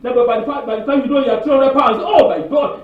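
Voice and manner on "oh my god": mock shock. Shock at three hundred pounds.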